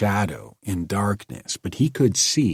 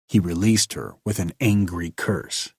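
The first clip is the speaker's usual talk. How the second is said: ordinary delivery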